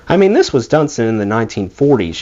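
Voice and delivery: plain reading voice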